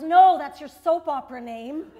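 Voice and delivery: a customer service lady, pretend offended; mad customer service lady. Customer service lady correcting a caller, mock mad. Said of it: "she gets really mad and goes".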